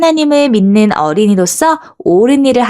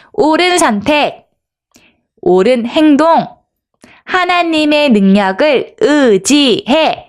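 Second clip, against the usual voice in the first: higher-pitched slogans with pauses between; lead-along call-out; 5 semitones higher, slower than usual. Shown as a demonstration. Teacher leads children in shouting slogans, measured call-out. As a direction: call out each phrase, stretching the last. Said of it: "우리 한번 외쳐볼까요".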